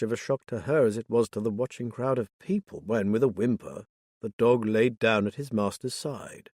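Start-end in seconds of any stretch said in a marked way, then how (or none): none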